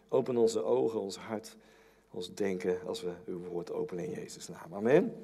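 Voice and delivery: hushed, earnest prayer voice that sinks low; opening prayer. Acted. Preacher praying before the sermon, hushed and earnest.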